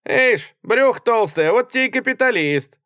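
high, loud mocking call; laughing jeer. A narrator's reading. Soldier laughs at the fat passenger, jeering. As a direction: crow it as a taunt. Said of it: "смеясь сказал солдат".

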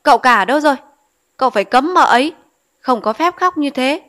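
mother-in-law furiously calling her son to forbid the crying; angry calling out. Acted. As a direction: call for the son in a rage. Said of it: "Bà Tuần giận quá gọi".

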